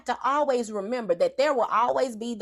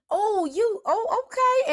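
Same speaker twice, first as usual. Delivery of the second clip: surprised; 10 semitones higher, slower than usual. Retold or imitated. Relative realising the mistake.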